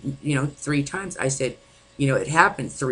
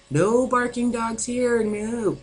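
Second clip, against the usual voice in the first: mocking the liar; 9 semitones higher, slower than usual. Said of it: "Lie, lie, lie, lie, lie".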